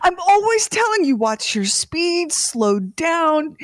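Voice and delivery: high, strained scolding after choking; acted-out worked-up mom, short of air; breathless scolding. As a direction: drag out the warning in a high voice. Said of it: "as soon as I can get some energy to form some words".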